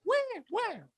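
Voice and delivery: very high, soft screeching sting; Psycho shriek imitation. Host hinting at Hitchcock's Psycho, mimicking its shriek. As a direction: squeal it like horror-movie violins.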